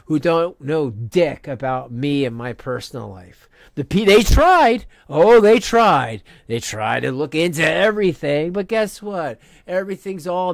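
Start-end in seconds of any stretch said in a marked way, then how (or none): 3.8-6.2 loud, cutting rant at her critics. Real, not acted. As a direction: hammer home that they tried. Angry rant at chat critic.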